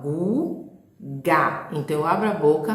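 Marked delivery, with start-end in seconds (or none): 0.0-1.7 Guga said in two clear beats, Gu and ga; syllable demo. Shown as a demonstration. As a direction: open the mouth twice on Guga. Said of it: "E guga da mesma forma".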